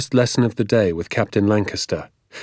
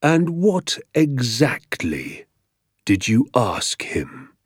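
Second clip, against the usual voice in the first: very slow, measured, dangerous question; controlled, drawn-out teacher's threat; quiet menace; 3 semitones higher, much slower than usual. A narrator's reading. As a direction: stretch each word out threateningly. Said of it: "speaking more quietly now and far more dangerously".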